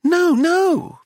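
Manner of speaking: very high, insistent wife; shrill, scandalised wife; shrill protest. A narrator's reading. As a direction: cry out in protest, pitched right up. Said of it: "the wife shrilled".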